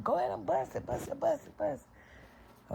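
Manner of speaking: high taunt fading to a breathy hush; mocking dare that dies away. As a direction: goad her, letting it trail off. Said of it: "I'm like".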